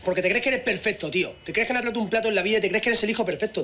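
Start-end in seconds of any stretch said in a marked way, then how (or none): none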